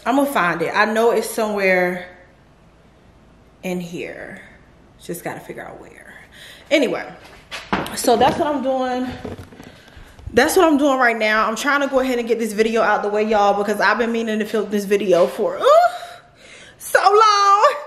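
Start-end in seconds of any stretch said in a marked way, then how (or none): 3.6-6.1 near-whispered, quick aside to herself; muttering to self. Real, not acted. Muttering while searching. 15.6-17.8 high laughing squeal on ooh. Real, not acted. Laughing at own delay.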